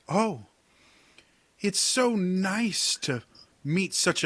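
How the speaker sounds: high, slow, sugary mock politeness; sarcastic sweetness. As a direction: gush with fake admiration to cut him down. Soligny mocks the captain.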